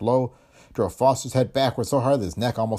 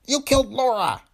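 high, squeaky little man; piping squeak of a small tough; 4 dB louder than usual. A narrator's reading. Dillon accusing Foster in a high squeak. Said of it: "he said in his squeaky voice".

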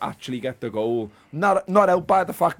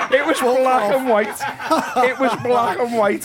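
high, loud, through laughter; incredulous and laughing hard; real laughing disbelief; 10 semitones higher, 5 dB louder than usual. Real, not acted. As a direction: crack up while insisting on it. Cracking up over the telly.

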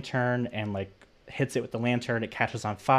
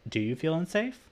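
a higher, suddenly probing mock-therapist tone; change in tone; 7 semitones higher than usual. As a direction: turn the question faintly sinister. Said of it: "a change in the tone".

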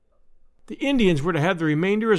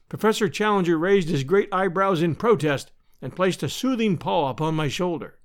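ordinary delivery

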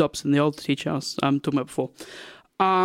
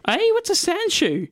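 slow, high-pitched puzzled question; mock bafflement; 13 semitones higher, slower than usual. Newcomer hears unfamiliar slang, mock bafflement. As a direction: ask it high and drawn out. Boy baffled by unknown word.

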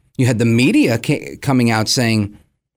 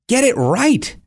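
ordinary delivery

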